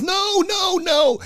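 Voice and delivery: put-on high, small child's voice denying fast; scared kid. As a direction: squeak it out like a startled ten-year-old. Kid denies to Muhammad Ali.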